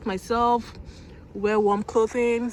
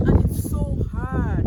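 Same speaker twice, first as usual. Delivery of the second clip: straining; 5 dB louder than usual. Real, not acted. Straining at a hard snowball.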